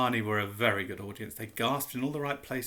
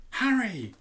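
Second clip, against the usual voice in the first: excited call; 9 semitones higher, 4 dB louder than usual. Acted. Hermione excited cry.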